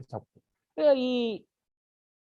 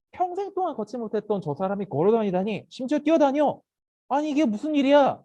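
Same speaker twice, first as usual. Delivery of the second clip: raised, high-pitched disbelief; astonished; 6 semitones higher than usual. Acted. Onlookers seeing the lame man walk, astonished. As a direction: exclaim as a stunned onlooker.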